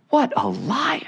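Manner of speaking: high, soft, incredulous exclamation. Real, not acted. Preacher reacting to Satan's great lie, incredulous. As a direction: pitch it way up in hushed disbelief.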